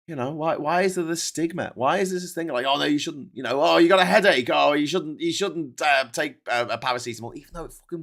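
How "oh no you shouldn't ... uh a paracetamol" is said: higher, louder nagging scold; mock tut-tutting about a headache pill. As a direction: nag like a preachy busybody. Mocking stigmatising attitude.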